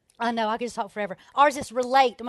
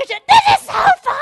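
high, loud laughing scream; shrieking with laughter over the noise; 15 dB louder, slower than usual. Retold or imitated. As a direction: scream it over the roar, delighted. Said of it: "You have to scream 'cause you can't hear".

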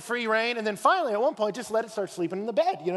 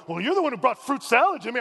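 louder, higher mock comeback; God imagined snapping back at Cain, mock peeved; mock defensive snap; 5 semitones higher, 6 dB louder than usual. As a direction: do a petty indignant retort. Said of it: "God doesn't bow up and go".

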